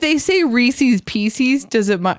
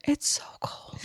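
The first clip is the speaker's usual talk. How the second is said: breathy whisper, barely voiced; 14 dB quieter than usual. Real, not acted. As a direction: whisper it, shivering and pitiful. Whining about the cold morning.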